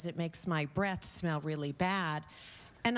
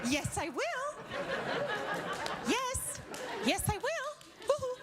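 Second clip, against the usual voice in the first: put-on very high, excited little-boy squeal; squealing giddy boyfriend. Crybaby boyfriend squealing yes to his girlfriend, giddy. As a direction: squeal yes like a thrilled toddler. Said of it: "boyfriend's eager reply to the bribe".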